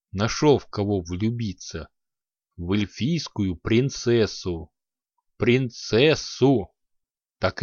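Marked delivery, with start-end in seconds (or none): none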